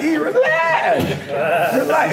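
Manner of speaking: high, slow, laughing reassurance; calming self-talk. Retold or imitated. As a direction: soothe yourself through a laugh. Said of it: "I'm like".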